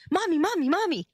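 put-on high, loud little kid calling mommy; child's urgent mommy call; imitating a panicked child. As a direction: mimic a scared child tugging at mom. Child waking mom in panic.

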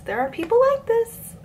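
"There are people like this" squeaked very high; comic high-pitched exasperation; exasperated squeal. Shown as a demonstration. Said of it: "repeated with big pitch jump".

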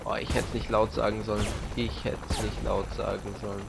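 ordinary talk

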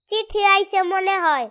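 usual speaking voice

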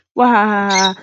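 hammed-up evil laugh, loud; mock evil guffaw; villain laugh. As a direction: cackle like a cartoon villain.

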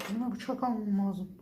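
ordinary delivery

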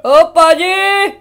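loud, slow, drawn-out hero call; booming character voice. Pretend. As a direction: announce the name like a big hero.

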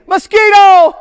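high excited shout; shouted answer. Shown as a demonstration. Presenter shouting the answer to the kids, high and loud. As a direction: yell it out with glee. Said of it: "Everybody say".

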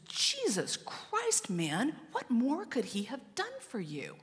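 acted high-pitched exasperation, stretched out; seething mother. Mother standing to rebuke her adopted son, seething in retelling. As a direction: draw out the oath in high, controlled outrage. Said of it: "she's seething".

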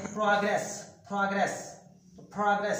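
प्रोग्रेस said prah-gress, open first vowel; teacher drilling प्रोग्रेस as prah-gress; pronunciation demo. Shown as a demonstration. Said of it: "इसकी pronunciation है".

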